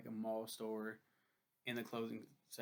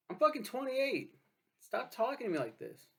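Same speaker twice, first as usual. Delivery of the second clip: much higher and louder, furious; furious outburst; 11 semitones higher, 7 dB louder than usual. Real, not acted. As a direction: blast it back in outrage. Angry at being talked down to.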